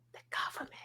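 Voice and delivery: whispering the government, secretive; whispered. Retold or imitated. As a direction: hush it like a conspiracy secret. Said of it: "She's like".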